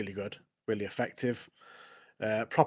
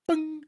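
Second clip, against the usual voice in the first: high-pitched bang sound effect; imitated bang. Acting out the blast for co-host, imitated bang. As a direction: pop out a high little bang.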